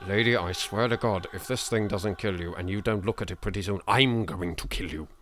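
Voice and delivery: usual speaking voice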